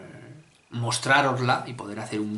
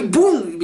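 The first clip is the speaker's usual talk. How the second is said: imitated bang; 10 semitones higher, 6 dB louder than usual. Dog jumps out suddenly.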